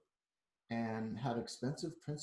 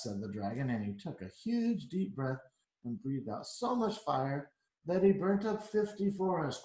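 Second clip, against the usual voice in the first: plain reading voice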